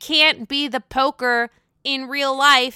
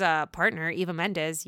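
ordinary talk

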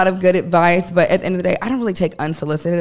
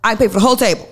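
a hint of the Jamaican guy, a little higher and louder; mild Jamaican-guy voice boasting he'll pay the whole table; 6 semitones higher, 5 dB louder than usual. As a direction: lightly voice the brash Jamaican guy. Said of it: "short little Jamaican guy".

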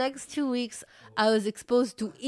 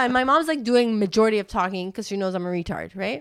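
ordinary delivery

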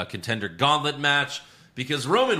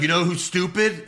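higher, louder jeering question; mock Roman taunt; 8 semitones higher, 5 dB louder than usual. Riffing on Roman's promo, loud jeering taunt. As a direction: lean in and taunt. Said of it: "He's like".

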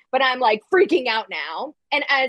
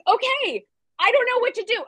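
high panicked helplessness; 11 semitones higher than usual. Retold or imitated.

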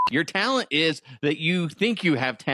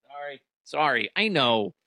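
ordinary talk, nothing marked

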